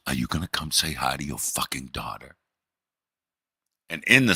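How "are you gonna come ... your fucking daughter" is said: hushed, seething demand; a wife poking her head out the door, seething in a hushed voice; angry whisper. Retold or imitated. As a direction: hiss it through the doorway. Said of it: "in that voice".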